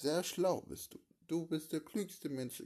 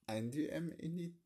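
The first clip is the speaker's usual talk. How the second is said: ordinary delivery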